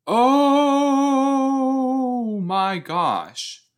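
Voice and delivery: long high loud drawn-out oh, dropping at the end; shocked gasp-cry. Real, not acted. Viewer floored by what Miss Pearl did, shocked.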